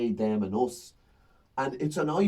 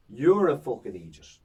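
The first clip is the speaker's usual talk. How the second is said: louder, punched insult at a heckler; mock stage insult; 5 dB louder than usual. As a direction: land the insult hard on the heckler. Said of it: "and I go".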